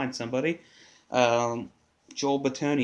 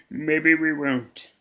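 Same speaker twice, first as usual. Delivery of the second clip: slow, drawn-out, louder playful tease; teasing drawl; 4 semitones higher, 7 dB louder, much slower than usual. Pretend. Host teasing listeners about a possible phone caller, slow playful drawl. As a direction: stretch it out like a tease.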